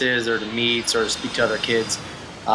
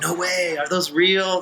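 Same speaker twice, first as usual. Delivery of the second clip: higher-pitched mock astonishment; voicing an impressed onlooker, pitched up; imitated amazed girl; 7 semitones higher, slower than usual. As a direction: play a girl's disbelieving gasp of a question. Imitating amazed girl behind him.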